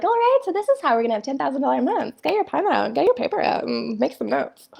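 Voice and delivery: high, fast pep-talk parody; mock business coach. Mimicking a coach rushing into money tips, mock perky. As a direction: rattle it off like an over-eager coach.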